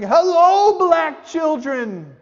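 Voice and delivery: high, loud, slow hello; mock yelled hello; shouted greeting. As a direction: call out the greeting, drawn out. Reenacting greeting in neighborhood.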